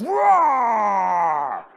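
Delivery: loud, drawn-out mock roar; boy's warrior cry. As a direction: imitate a small boy's battle roar. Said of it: "he goes".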